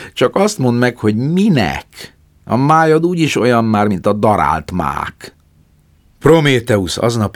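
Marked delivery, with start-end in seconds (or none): 0.1-5.4 louder, higher sneering bird voice; mocking vulture. A narrator's reading. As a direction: taunt him cruelly. Vulture mocks Prometheus. (a keselyű gúnyosan Prométeuszhoz, hangosan)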